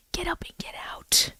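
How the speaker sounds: breathy ghostly whisper of the voice; creepy whisper. Acted. Creepy whisper of the voice telling her to get out. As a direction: hiss it like the unseen voice in the house.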